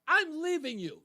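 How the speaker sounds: high, dramatic parting threat, falling at the end; mimicked wife's threat. Host imitating first wife threatening to leave, mock-dramatic. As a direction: imitate a wife announcing she's going. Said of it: "My first wife was like that".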